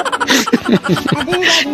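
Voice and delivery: real laughter at own joke; high real laugh. Real, not acted. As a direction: burst of high laughter at the punchline.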